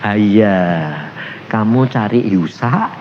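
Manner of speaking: low, soft, tender voice of God; gentle. Retold or imitated. God softly consoles the scolded visitor, gentle. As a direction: speak low and gently, stretching the ending. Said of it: "Lembut, ngomongnya gini".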